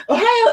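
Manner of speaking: OHIO said high, rising like the US state; mispronounced Japanese greeting. Retold or imitated. Learner trying the greeting, OHIO pitched high and wrong. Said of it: "I'll say".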